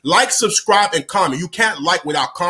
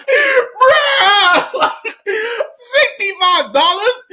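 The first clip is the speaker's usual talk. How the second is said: high, loud laugh through the words; real laughing outrage; laughing disbelief; 4 dB louder, much slower than usual. Real, not acted. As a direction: laugh in shock at the price. Disbelief at the bill.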